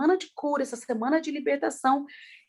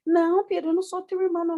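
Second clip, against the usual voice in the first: high-pitched mock protest; retold insistent denial; 7 semitones higher, much faster than usual. Swimming instructor contradicting an insistent pupil, acted puzzled denial. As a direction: imitate a bewildered correction, pitched up.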